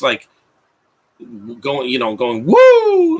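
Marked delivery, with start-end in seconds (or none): 2.4-3.2 high, loud, drawn-out whoop; Ric Flair. Retold or imitated. Mocking an actor's overacting, loud high whoop. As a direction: let out a big wrestler's "woo". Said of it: "like Ric Flair".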